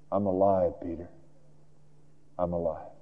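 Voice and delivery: very soft, low and intimate; tender reassurance. Acted. Jesus telling grieving Peter the news, softly and tenderly. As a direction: let it sink in gently, nearly hushed.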